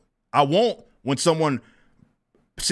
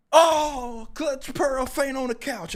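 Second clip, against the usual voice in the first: mock swoon; 8 semitones higher, slower than usual. Streamer mocking a pearl-clutching accuser, mock swoon. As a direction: let it fade like a hammed-up faint.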